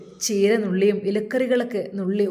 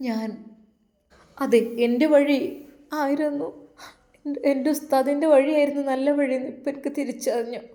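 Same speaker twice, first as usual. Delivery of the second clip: put-on young woman confessing; 2 semitones higher than usual. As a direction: pitch up as the remorseful young wife pouring out her story. Narrator voices Zeenath remorseful. (ഉയർന്ന സ്വരത്തിൽ കുറ്റബോധത്തോടെ ഏറ്റുപറയുന്ന യുവതി)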